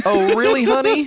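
high breathy mock swoon; swooning sales lady. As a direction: coo it like a smitten saleswoman.

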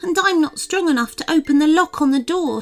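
high, small fairy voice; tiny fairy pleading. A narrator's reading. Fairy begs children to free her friends, high voice. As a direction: plead for help as a little fairy.

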